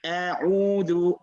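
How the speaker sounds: teacher's careful A'udhu; throat-letter demonstration. Shown as a demonstration. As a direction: model A'udhu, squeezing the ain mid-throat. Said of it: "you went to the middle of the throat to pronounce the Ain".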